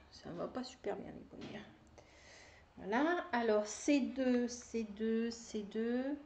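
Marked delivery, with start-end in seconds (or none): none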